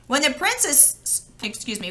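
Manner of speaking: usual speaking voice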